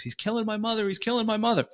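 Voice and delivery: put-on high, frantic boy's cry, a light retelling; hysterical screaming. Boy running down the stairs begging cops to save his mother, high frantic mock scream. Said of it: "he's screaming hysterically".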